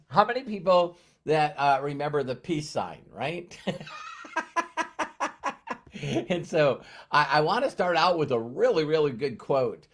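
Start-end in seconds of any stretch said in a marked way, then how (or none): 3.6-6.2 long high laugh. Real, not acted. Laughs after peace sign remark.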